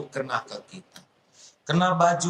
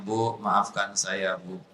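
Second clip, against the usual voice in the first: humble apology; 7 semitones lower, slower than usual. Acted. Husband apologizes to wife.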